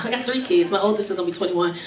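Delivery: normal talking voice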